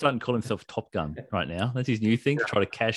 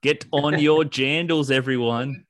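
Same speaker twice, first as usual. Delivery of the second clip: put-on New Zealand accent; 2 semitones higher, 5 dB louder, slower than usual. Kiwi slang bit.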